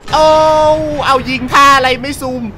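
high, loud whoops broken by laughter; excited shouting. Real, not acted. Gamer watching his sniper kill, shouting excitedly and laughing. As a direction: yell at the screen, cracking up.

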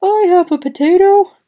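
loud called-out offer; calling out. A narrator's reading. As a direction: call out from the back of the crowd. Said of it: "A deep voice in the back of the crowd called out".

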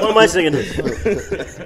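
high and slow through laughter; laughing. Real, not acted. Laughs at his own singing.

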